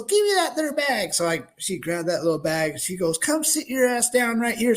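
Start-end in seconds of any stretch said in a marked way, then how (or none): 0.0-1.1 higher, demanding lady voice; bossy biker lady. Retold or imitated. 3.2-4.7 higher, demanding lady voice; bossy biker lady. Retold or imitated. Biker lady ordering him.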